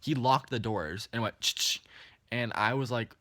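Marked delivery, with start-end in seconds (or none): 1.4-1.8 sharp ch-ch of door locks; quick mouth-made locking sound; imitated lock noise. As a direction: imitate car doors locking. Imitates car doors locking.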